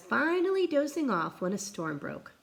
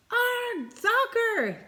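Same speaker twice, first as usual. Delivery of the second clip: crew yell; much slower than usual. Acted. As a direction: yell it back together. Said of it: "the crew yelled".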